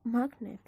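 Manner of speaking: "magnet" with the first syllable MAG stressed. Shown as a demonstration. Teacher pronouncing the word without suffix, MAG stressed in "magnet". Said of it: "if there's no suffix".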